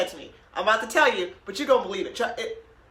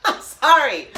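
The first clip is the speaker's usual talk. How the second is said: normal talking voice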